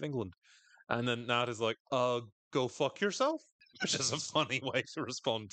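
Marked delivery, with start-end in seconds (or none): 1.9-3.4 "go fuck yourself?" ending on a questioning rise; rising question tune. Retold or imitated. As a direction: say "yourself" as if asking. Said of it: "I love the implied question mark".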